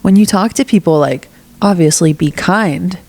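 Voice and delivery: ordinary delivery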